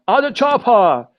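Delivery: put-on high-pitched German-accented action hero yell; Schwarzenegger. Teacher copying a famous movie line, German-accented yell. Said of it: "with his German accent".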